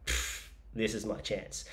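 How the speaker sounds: soft scheming aside; sneaky aside. Retold or imitated. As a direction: say it under your breath.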